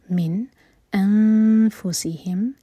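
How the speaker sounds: voiced, very slow tajweed recitation; slow Quran recitation. Shown as a demonstration. Teacher recites the verse to show the rules, slowly. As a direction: draw each syllable out carefully. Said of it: "نون پر اظہار".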